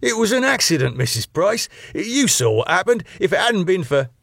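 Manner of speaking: imploring. A narrator's reading. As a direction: beg her to believe you.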